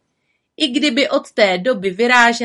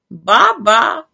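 louder knowing raven voice; agreeing raven; 3 semitones higher, 8 dB louder than usual. A narrator's reading. Raven agrees smugly with the witch. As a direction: nod along smugly. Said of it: "přizvukoval Abraxas".

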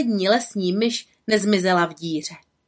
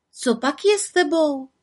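higher, gentle asking; compassionate; 7 semitones higher than usual. Acted. Little witch asks the sad woman kindly. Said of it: "ptala se soucitně".

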